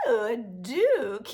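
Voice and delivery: slow, drawn-out fascinated coo; put-on swooning wonder; mock awed. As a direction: act swept up by the title, drawing it out. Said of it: "programming conditioning in me was like".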